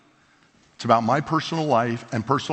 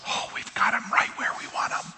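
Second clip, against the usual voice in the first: soft secret whisper, lower; sly whisper; 7 dB quieter than usual. Retold or imitated. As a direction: let God whisper it like a knowing secret. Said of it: "And God says".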